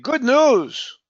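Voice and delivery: "good news" lifted and drawn out; "good news" set apart with extra energy; stress on "good news". Shown as a demonstration. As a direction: make "good news" sound special. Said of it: "To make it sound special".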